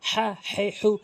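"হা হে হু" squeezed from the middle of the throat. Shown as a demonstration. Teacher drilling learners, "হা হে হু" from mid-throat. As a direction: press the h of "হা হে হু" in the throat. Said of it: "হরফের মাঝখান থেকে উচ্চারণ করবেন".

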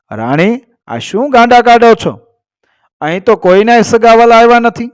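high-pitched, baffled protest; storyteller voicing an indignant denial. A narrator's reading. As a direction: push back against a wild accusation. King denies queen's angry accusation.

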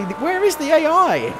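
high, amazed cry; can't believe it's gone; surprised. Real, not acted. Overtakes everyone in race.

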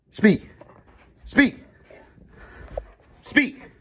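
very high, eager speak; eager command. Real, not acted. Urging dog to bark.

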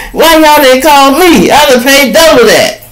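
high, loud, outraged whine; TMZ complaining. Acted. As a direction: squeal like a jilted buyer. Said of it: "I know T M Z saying".

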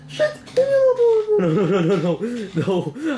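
high loud wail of no, half laughing; panicked laughing no. Real, not acted.

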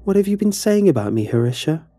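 high, quick, falsely pleasant bully; a cat toying with its catch; menacingly pleasant. Acted. Alice corners Harisha with a fast, fake-pleasant menace. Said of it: "used a calm, almost pleasant voice".

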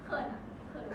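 embarrassed whisper; shy whisper. Real, not acted. Embarrassed player whispering to friends that she's shy. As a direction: whisper it bashfully.

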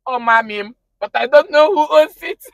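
high, loud mock protest; flustered denial. Acts out sister's flustered denial, laughing.

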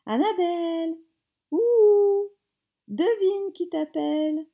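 loud, high call across the house. Acted. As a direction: shout for her to come. Groom calls out to bride.

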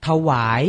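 ถวาย said carefully as tha-wai, rising tone at the end; careful teaching read of ถวาย; pronunciation model. Shown as a demonstration. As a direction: model ถวาย for learners in two syllables. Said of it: "อ่านว่า".

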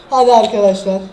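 ordinary talk, nothing marked

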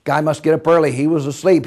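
ordinary delivery

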